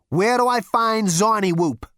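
loud, cocky and impatient; brash Zaphod. Acted. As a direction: throw your weight around. Zaphod demanding.

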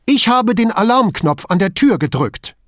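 high, loud, heated denial; agitated protest at a high pitch; angry or panicked. A narrator's reading. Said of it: "lief rot an vor Zorn oder Panik".

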